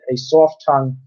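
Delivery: usual speaking voice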